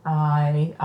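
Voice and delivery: normal talking voice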